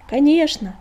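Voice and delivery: loud shout over the wind; shouting back. A narrator's reading. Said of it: "прокричал он в ответ".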